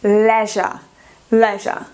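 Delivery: "leisure" with a short open E, falling; teacher modelling "leisure" for students, short E. Shown as a demonstration. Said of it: "Também E I".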